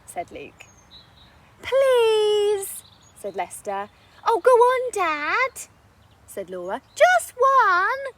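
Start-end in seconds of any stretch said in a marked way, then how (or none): 1.6-2.8 long, high, loud pleading please; whiny child begging dad. Acted. 4.2-8.0 put-on high, loud little girl wheedling; coaxing child. Child begging for story.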